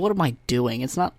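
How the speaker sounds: ordinary talk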